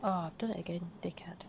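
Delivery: whispered self-scolding under breath; whispering. Real, not acted. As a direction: hiss at yourself under your breath. Muttering to himself at mistake.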